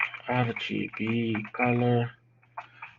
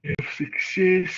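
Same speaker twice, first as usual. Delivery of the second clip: words stretched through a yawn; 8 semitones higher, 4 dB louder, slower than usual. Real, not acted. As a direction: yawn while reading it out. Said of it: "excuse me if I yawn".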